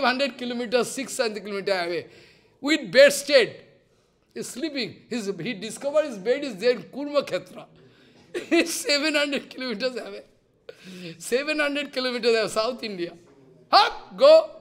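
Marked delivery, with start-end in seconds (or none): 2.6-3.5 loud with bedstead; louder punchline; emphatic punch. Real, not acted. Punchline of the story. 13.7-14.5 high loud shout sending him off; high, loud dismissal; mock shout. Jagannath throws him away.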